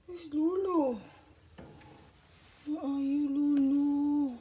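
loud, singsong calling out; searching pet call. Real, not acted. Owner searching for pet Lulu, loud singsong call. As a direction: call out loudly for a hiding pet.

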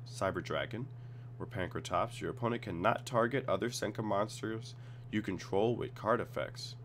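ordinary talk

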